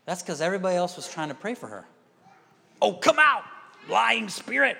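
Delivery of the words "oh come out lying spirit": raised, high shouted command; mock deliverance shout. Mocking prayers shouting at the woman's spirit. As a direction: bark out the rebuke like an exorcist. Others trying to cast out spirit.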